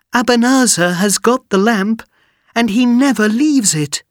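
high, loud anxious warning; worried princess. Acted. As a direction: warn him anxiously.

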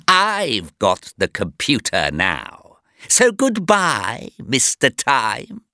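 taunting villain voice; gloating villain. Acted. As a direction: savour each word before leaving.

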